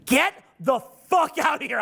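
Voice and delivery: high, strained mock yell; imitated angry outburst. Son orders dad away after being told chill, imitated outburst. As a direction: play a teenager losing control at Dad.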